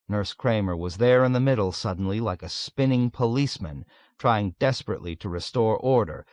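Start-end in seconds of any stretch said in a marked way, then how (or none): none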